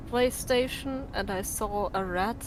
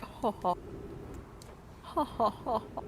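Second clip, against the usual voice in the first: rich man laugh; 8 dB quieter than usual. Shown as a demonstration. Streamer demoing the laugh that beats noise cancellation, rich man laugh. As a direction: chuckle like a lazy rich golfer. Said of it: "I have to do the rich man laugh".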